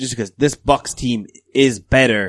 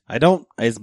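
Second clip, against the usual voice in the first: normal talking voice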